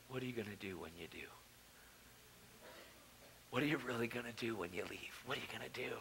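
very low, hushed divine questioning; voice of God. Retold or imitated. God questioning a man who wants to leave, low hushed voice. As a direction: ask it softly and low, unhurried in authority. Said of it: "God spoke to me".

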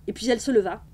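ordinary delivery, nothing marked

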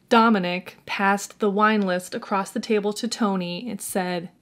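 ordinary talk

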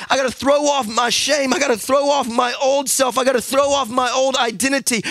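loud, high preacher's cadence over music; preaching at full force. Acted. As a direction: preach it out, rising over the band.

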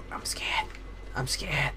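soft, whispery scared confession; hushed fear. Real, not acted. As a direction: murmur it while hiding. Hiding from enemy in game.

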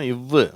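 இவ்வு as a short i into a held v sound. Shown as a demonstration. Teacher giving the sound of W, drawn-out v. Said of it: "உச்சரிப்பு தெரியும்".

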